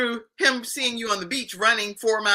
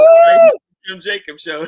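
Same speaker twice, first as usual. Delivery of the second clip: excited; 13 dB louder than usual. Real, not acted. Host hyping exclusive reveal.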